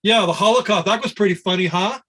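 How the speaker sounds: mock it with bitter irony; imitating an indignant monk's sarcasm; sarcastic mockery. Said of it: "it was the opposite mood".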